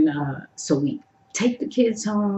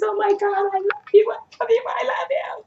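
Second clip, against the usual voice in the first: high, rising squeal, each "I love you" climbing higher; starstruck gushing; 14 semitones higher, faster than usual. Retold or imitated. Fan gushing to the singer in front of her, high squealing. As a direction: gush it like a fan losing all composure.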